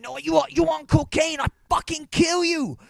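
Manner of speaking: hammed-up furious screaming; mock furious yell. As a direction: stripper screaming accusations at him. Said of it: "she starts going fucking ape shit".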